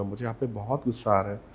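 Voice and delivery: quiet, low-pitched anger; low-toned anger. Shown as a demonstration. Showing anger still felt in a low tone, quiet anger. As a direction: voice anger softly, keeping the tone down. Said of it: "आप गुस्सा करेंगे तब भी आपको गुस्सा feel होगा".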